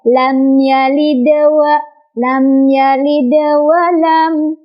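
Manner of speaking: slow chanted "lam yalid wa", the d lightly echoed; small bounce. Shown as a demonstration. Teacher models small qalqalah, lightly bounced "yalid". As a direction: bounce the d of "yalid" slightly, then carry on to "wa". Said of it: "pantulannya kecil aja".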